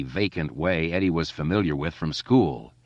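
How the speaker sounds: plain reading voice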